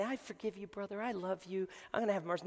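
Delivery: hushed, pretend-loving forgiveness; mock mercy. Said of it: "pull him up and say".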